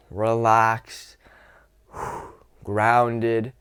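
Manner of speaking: relaxed tone, exhaling between words; slow down with long, settling breaths; calm, grounded. Shown as a demonstration. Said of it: "this more calm, relaxed, grounded".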